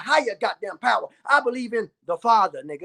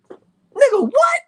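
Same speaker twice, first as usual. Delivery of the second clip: loud, high-pitched yelp of disbelief; incredulous outburst; 13 semitones higher, 7 dB louder, much slower than usual. Acted. YouTuber reacting to the ninja's intro, loud and incredulous. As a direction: leap way up in pitch and volume.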